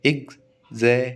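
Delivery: "एग्जामिनेशन" as एग, ज़ा, मि, ने, शन; split into syllables. Shown as a demonstration. Teacher counting five syllables, "एग्जामिनेशन" broken into five. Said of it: "पांच syllable हो गए".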